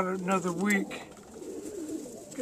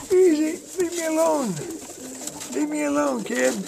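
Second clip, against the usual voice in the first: raised, startled pleading; high fending off; 6 semitones higher than usual. Real, not acted. Breeder fending off a biting pigeon, high and startled. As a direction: push the bird away, voice up high. Biter bird attacking him.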